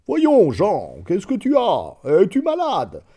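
a baron worried about his servant; father's concerned voice. A narrator's reading. Father asking his servant.

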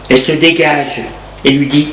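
ordinary delivery, nothing marked

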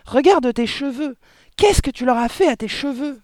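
high, loud outcry at her husband; shrieking in horror. A narrator's reading. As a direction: demand an explanation at a yelp. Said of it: "glapit la mère". (cri aigu, glapi et horrifié)

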